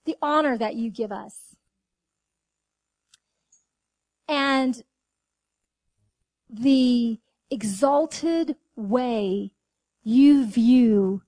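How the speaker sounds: hushed thanksgiving prayer. Real, not acted. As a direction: pray it gently, with long pauses. Closing prayer.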